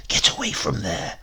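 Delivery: urgent whisper through clenched teeth; hissed. A narrator's reading. Dritto hissing at Baby during the break-in, whispered. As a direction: hiss it so no one outside hears. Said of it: "hissed Dritto through clenched teeth".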